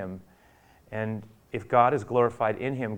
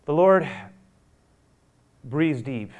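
ordinary talk, nothing marked

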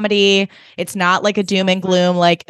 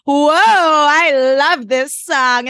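high, slow, overplayed nonchalance; fake casual; 6 semitones higher, much slower than usual. Joe pretending not to see them, fake casual. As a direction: fake casual to dodge being noticed. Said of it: "and goes".

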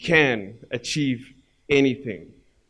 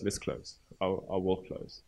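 soft repeat; 3 semitones lower, 9 dB quieter than usual. Real, not acted. Preacher closing, repeated softly.

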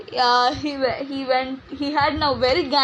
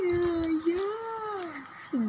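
put-on drunk voice; 5 semitones higher, 9 dB quieter than usual. Speaker plays drunk friend.

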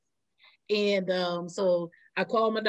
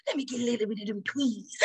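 Madea movie lady; 3 semitones higher, 5 dB quieter, faster than usual. Retold or imitated. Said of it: "that lady was like".

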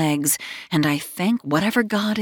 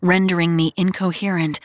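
ordinary delivery

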